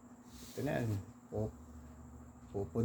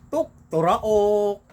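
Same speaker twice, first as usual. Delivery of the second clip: loud, high, slow rooster crow; 8 semitones higher, 9 dB louder, much slower than usual. Retold or imitated. Storyteller imitates the rooster that gave the owner away, crowing. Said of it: "alam mo yung tono?".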